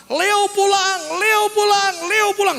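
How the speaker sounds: high, loud mock yell; imitated shout. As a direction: yell it from the back, imitating the woman. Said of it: "saya teriak dari belakang". (teriakan tiruan, lantang dan melengking tinggi)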